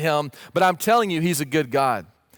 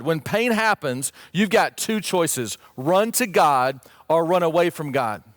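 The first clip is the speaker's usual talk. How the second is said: ordinary talk, nothing marked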